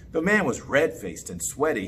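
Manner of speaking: ordinary delivery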